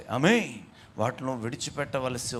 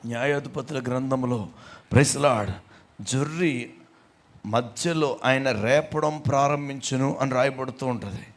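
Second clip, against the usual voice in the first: ordinary talk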